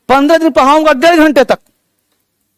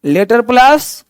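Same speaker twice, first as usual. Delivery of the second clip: Letter plus, drawn out slowly with a rise on plus; slow stressed phrase; much slower than usual. Shown as a demonstration. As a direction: stretch out "letter plus" and lift plus. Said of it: "पढ़ा रहा हूं आपको letter plus sound". ("Letter plus" को धीरे धीरे, plus पर ऊपर उठाते हुए)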